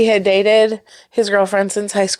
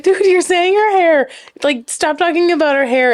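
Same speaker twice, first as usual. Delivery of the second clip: higher-pitched retold protest; mock disbelief; 8 semitones higher than usual. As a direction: imitate baffled hosts cutting him off. Hosts interrupt the weeping dater.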